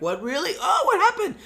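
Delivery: hammed-up shock over the blood; mock surprise. Seeing the blood on her hand, hammed-up surprise. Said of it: "and then is like".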